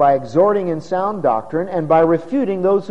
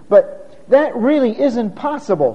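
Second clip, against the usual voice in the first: ordinary delivery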